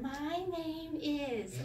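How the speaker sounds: slow, stretched-out sing-song introduction; kindergarten. Shown as a demonstration. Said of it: "you're like kindergarten".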